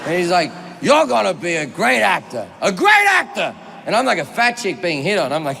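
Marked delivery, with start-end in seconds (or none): 0.8-3.5 emphatic movie-star proclamation, leaping up on the repeat; hammed-up shouted praise; Al Pacino. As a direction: proclaim it bigger the second time. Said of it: "Al Pacino says".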